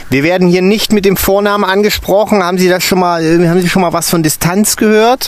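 high, loud and scolding; stern teacher. A narrator's reading. Stern teacher scolding the intern about first names. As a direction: lecture the newcomer on distance.

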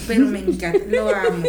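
hammed-up loud adoring squeal; gushing mom. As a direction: squeal it like a doting mother. Imitating gushing mothers.

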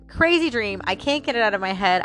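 normal talking voice

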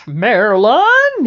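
loud drawn-out call of Marilyn; husband calling his wife from the front door; yelling for his wife. Retold or imitated. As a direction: shout her name down the hall. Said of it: "And he yells".

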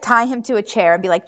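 usual speaking voice

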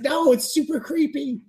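very high mock horror; shrill disgusted squeal. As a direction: screech it like a grossed-out cartoon boy. Boy disgusted in recap.

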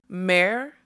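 loud, rising indignant cry; affronted unicorn's loud question; shrill trumpeting. A narrator's reading. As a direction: trumpet it in outraged disbelief. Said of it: "trumpeted the word so shrilly".

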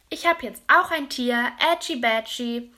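put-on excited child calling out; called out. A narrator's reading. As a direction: shout it out proudly, holding up the jar. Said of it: "hat er gerufen".

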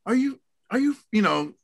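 high, exasperated mock scolding; mock mad parent. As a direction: replay your frustration at the daughter, pitched high. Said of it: "and I just get mad".

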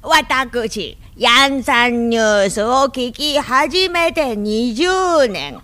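put-on slow, drawn-out old lady testimonial; green-juice commercial granny. Guest parodying a green-juice commercial for the host, slow granny testimonial. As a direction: imitate an amateur granny in an advert. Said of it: "ミラクルひかるの青汁のおばあちゃん".

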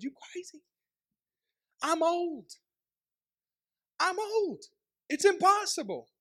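high-pitched acted disbelief; mock incredulous protest, rising in volume; incredulous wife. Acts Elizabeth's disbelieving reply.

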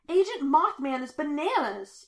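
high, loud, frantic outburst; furious shout. A narrator's reading. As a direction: shout it in outraged disbelief. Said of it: "he shouts".

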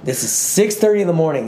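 ordinary delivery

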